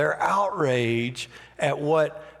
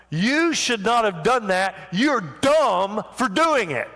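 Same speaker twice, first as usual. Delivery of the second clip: higher-pitched belittling rebuke; scolding; 6 semitones higher than usual. Acted. Someone belittling another person, a higher scolding tone. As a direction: talk down to her for doing it. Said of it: "the equivalent of someone saying".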